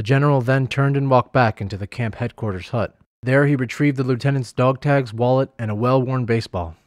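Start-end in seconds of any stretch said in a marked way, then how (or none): none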